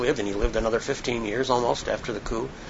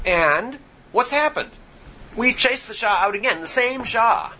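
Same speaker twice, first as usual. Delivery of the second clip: indignant; 8 semitones higher than usual. Retold or imitated. Hostage takers' view voiced.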